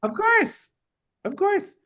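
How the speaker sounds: very high, indulgent agreement. Real, not acted. Host agreeing with a child, high child-directed voice.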